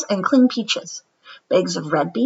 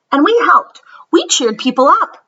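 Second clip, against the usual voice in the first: proud; 7 semitones higher, 5 dB louder than usual. Acted. Molly proudly insists.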